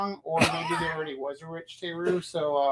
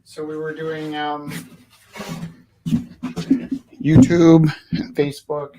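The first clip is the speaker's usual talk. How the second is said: ordinary delivery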